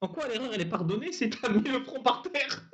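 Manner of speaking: ordinary talk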